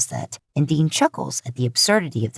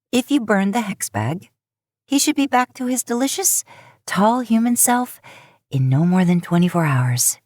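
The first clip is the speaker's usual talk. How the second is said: higher, playful witch voice; a raised, mischievous witch explaining her spell; teasing witch; 5 semitones higher than usual. Acted. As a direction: toy with him, sweetly smug.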